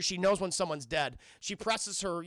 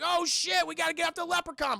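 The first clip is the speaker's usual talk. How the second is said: high, loud, rapid acted alarm; urgent alarm; 10 semitones higher, 5 dB louder than usual. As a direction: blurt it out as a panicked squad. Acts out alarmed Marines.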